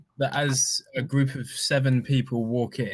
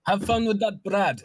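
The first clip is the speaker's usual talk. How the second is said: cheeky character voice; 7 semitones higher than usual. Acted. Acted parting quip.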